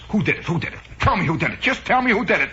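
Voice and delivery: high, urgent mother demanding answers; hammed-up protective mom on the warpath; indignant mother. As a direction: fire off frantic questions, rising in pitch. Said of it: "immediately the mother says".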